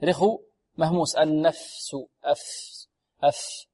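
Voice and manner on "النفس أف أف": "أف" with a lengthened, airy f; soft voiceless fa. Shown as a demonstration. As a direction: let the breath run on through the f of "النفس". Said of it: "والفاء هنا حرف رخو مهموس". (فاء رخوة مهموسة في "النفس")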